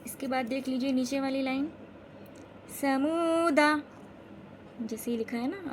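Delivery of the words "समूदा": "समूदा" chanted slowly, long oo, short final daa; final alif unstretched. Shown as a demonstration. Teacher showing students, "समूदा" without stretching the last alif. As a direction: hold the middle of "समूदा", not its end. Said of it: "अलिफ को बिल्कुल नहीं खींचेंगे".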